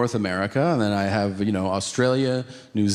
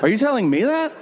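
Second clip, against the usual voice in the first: incredulous question; 9 semitones higher, faster than usual. Retold or imitated. Baffled reply to guard.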